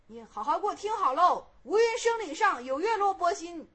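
loud, high, emphatic pronouncement; stern command. Acted. Master ordering the monk to listen, stern command. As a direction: declare it forcefully to a pupil.

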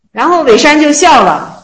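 ordinary talk

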